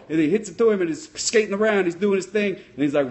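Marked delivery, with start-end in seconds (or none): none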